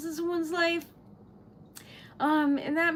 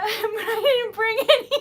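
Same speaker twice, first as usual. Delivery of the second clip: teary laughing; 6 semitones higher, 7 dB louder than usual. Real, not acted. Overwhelmed streamer laughing tearfully.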